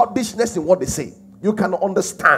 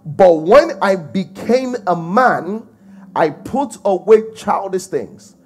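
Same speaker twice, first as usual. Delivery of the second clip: slow scripture recitation; 4 dB louder, slower than usual. Acted.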